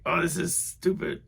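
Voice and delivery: a hint of an irritated man; mild mock exasperation. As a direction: toss it off, annoyed. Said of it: "it sounded kind of like".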